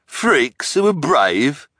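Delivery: high, loud, slow sneer. A narrator's reading. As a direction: draw out the scornful question. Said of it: "the hook-handed man sneered".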